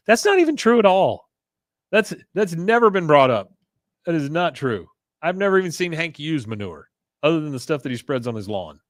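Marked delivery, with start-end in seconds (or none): 0.1-5.9 much higher, louder objection; real indignant outburst; indignant protest. Real, not acted. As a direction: protest the claim hotly. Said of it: "indignant aside".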